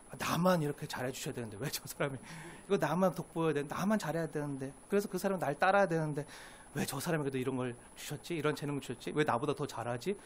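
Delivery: low, soft acted inner complaint; envious grumbling. Preacher voicing a believer jealous of another's gifts, envious grumbling. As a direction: grumble jealously to yourself, pitched well down. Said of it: "하면 시기하고 질투하는 일이요".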